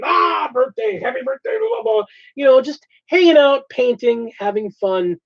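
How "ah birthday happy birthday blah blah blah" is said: overdone mock cheer. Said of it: "an overdone".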